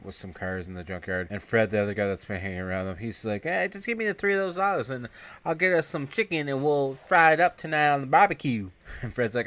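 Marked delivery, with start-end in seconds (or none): 3.4-8.7 higher put-on goofy buddy. Friend asking Fred for money, in a goofy high voice. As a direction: pitch a chicken plan in a silly high voice.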